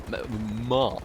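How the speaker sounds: a clipped posh British accent on "Map."; "Map." said in an accidental posh British accent; a fleeting, half-accidental posh British accent; slip into posh British accent. Real, not acted. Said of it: "I nearly had some sort of accent there".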